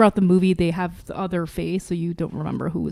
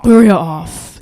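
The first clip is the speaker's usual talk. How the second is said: loud title call; segment announcer; 10 dB louder, slower than usual. Pretend. Host calling out the segment name, loud announcer.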